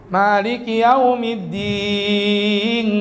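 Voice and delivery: slow recitation with the final N turned into "eng"; slow Bugis-accented Quran line; Bugis-style nasal N. Retold or imitated. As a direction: chant it like a Bugis imam, ending on ng. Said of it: "N-nya jadi eng".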